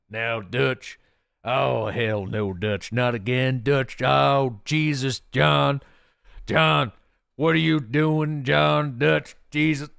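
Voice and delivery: loud, drawn-out game character impression; hammed-up cowboy protest; Red Dead character voice. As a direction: groan it out as a loud, exasperated gunslinger. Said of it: "I can't do a Dutch voice".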